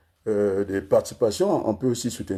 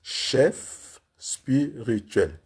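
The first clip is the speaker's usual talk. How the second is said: very slow, long gaps between syllables; 3 semitones higher, much slower than usual. Shown as a demonstration. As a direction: deliver it like a slow dictation. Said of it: "Il y a trois syllabes là".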